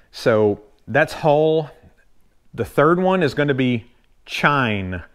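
ordinary talk

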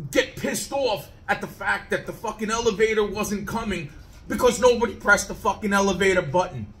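normal talking voice

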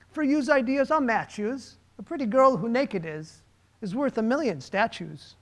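hammed-up Bronx accent reading a poem; rough Bronx accent. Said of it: "I wish I could do a better Bronx accent".

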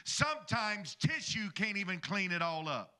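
ordinary talk, nothing marked

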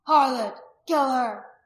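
loud, hostile crowd shouts; men shouting. A narrator's reading. Men demanding the woman's death, shouting. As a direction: bellow it from the mob. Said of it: "Some of the men shouted".

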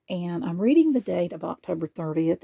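ordinary delivery, nothing marked

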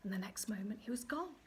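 soft unvoiced hush; whispering. A narrator's reading. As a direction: hush it, he's vanished. Narrator whispers vanishing.

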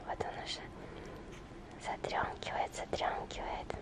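very soft fond whisper. Real, not acted. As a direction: whisper so the puppy sleeps. Whispering to sleeping puppy.

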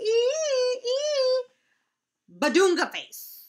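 loud, high gibberish spree with squeals; silly sounds. Pretend. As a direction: go wild on the nonsense noises. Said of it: "make silly sounds like".

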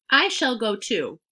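plain reading voice

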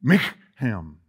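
"Mechem" with a throaty kh in the middle, stress on the first syllable; Hebrew word. Shown as a demonstration. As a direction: say "Mechem" as mih-KHEM, the kh scraped in the throat. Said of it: "Sounds like I'm clearing my throat".

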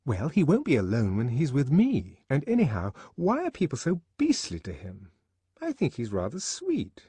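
put-on high, girlish, protesting voice; defending him. A narrator's reading. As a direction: stand up for him to a friend. Lenina defends Bernard to Fanny.

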